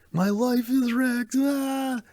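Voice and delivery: high, slow wail of despair; mock despairing wail. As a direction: wail like a heartbroken fan.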